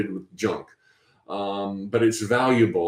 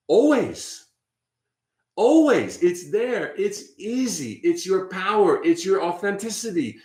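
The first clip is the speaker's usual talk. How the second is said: higher, swelling preacher's call; fervent inspirational preaching, voice raised high; uplifted preaching; 7 semitones higher than usual. Acted. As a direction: lift them up into the divine. Rising inspirational appeal.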